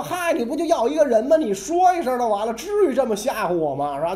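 high, loud aggrieved protest; mock-offended Korean king complaining to Qin; exasperated king. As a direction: whine like a bullied little king. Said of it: "那韩王说".

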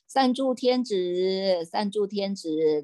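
slow, drawn-out call to a name; an unseen voice calling out slowly; mysterious voice calling. Retold or imitated. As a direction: stretch each syllable like a summoning voice. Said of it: "忽然有一个声音出来了".

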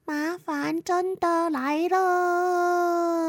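high, very slow sing-song bird voice; high, stretched-out chirpy announcement; pigeons' warning. Acted. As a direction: draw out every word like a calling bird. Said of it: "树上那些鸽子啊".

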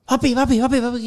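a hint of a small kid shaking dad awake; child calling dad. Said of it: "anak gue ngeprak-ngeprak gue begini".

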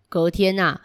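plain reading voice

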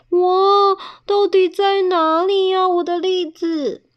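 high, loud plaintive call; distraught wail. Acted. Bear searching for lost chestnuts, distraught.

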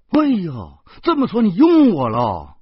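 loud, high burst of excitement; delighted realisation. A narrator's reading. Job-seeker to employer, thinking she is hired, thrilled. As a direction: exclaim it as if just hired.

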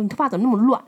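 loud, snapping scold about messy hair; harsh scolding. Retold or imitated. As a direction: bark it at her like an angry boyfriend. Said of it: "很凶". (凶巴巴地骂)

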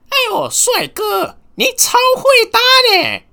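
very high, loud squeaky granny voice; hammed-up granny gushing at a handsome guy; old granny voice. Granny flirting with a handsome stranger on the street, high squeaky voice. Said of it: "一个阿嬷啊".

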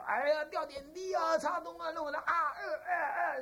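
high, drawn-out mock wail; imitating pained wailing. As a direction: cry out high like a patient being needled. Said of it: "叫了半天". (模仿病人高声哀叫)

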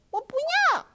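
high, sudden cry of no; a startled child crying out; startled refusal. Acted. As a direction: blurt it out in shock. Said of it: "艾莉吃了一惊".

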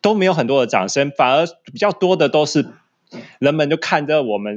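ordinary delivery

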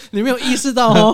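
laughing mockery. Mocking the husband's attitude.

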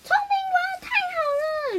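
put-on very high squeaky kid voice; a child thrilled by a magic pill; 兴奋的小孩. 绿豆冰听到聪明丸. (很高的尖细童声，兴奋)

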